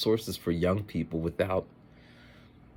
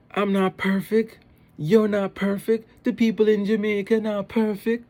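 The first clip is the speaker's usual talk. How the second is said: put-on sassy Jamaican boy; 14 semitones higher, 6 dB louder than usual. Little Jamaican boy talking back to mom, imitated sass. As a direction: declare it with exaggerated Jamaican child sass. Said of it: "there's a lot of sass".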